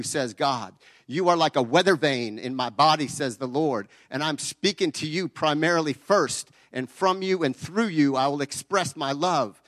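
preaching. Acted. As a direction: declare it like a revival preacher. Prophetic word spoken as God.

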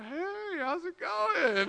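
high, slow, drawn-out hello; comically casual greeting. Retold or imitated. Team greeting the daughter beside her crying mom, comically casual. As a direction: stretch out an awkwardly friendly greeting.

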